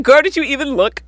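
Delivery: put-on high-pitched incredulous "girl"; high, teasing reproach; mock scolding. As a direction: scold her in playful disbelief. Mock-scolding a video character.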